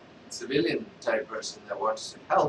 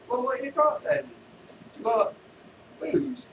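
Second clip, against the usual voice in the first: high beggar voice asking for food; street beggar; 11 semitones higher than usual. Acted. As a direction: pester him in a high voice. Beggar asks Vader for food.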